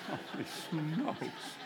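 very slow and soft through laughter; laughing it out. Real, not acted. Preacher laughs at imagining her dying mid-service, softly. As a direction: let the words dissolve in laughter. Laughing at his own fear.

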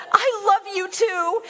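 high, elated reply, re-enacted; joyful retold reply. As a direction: act out a high, happy reply.

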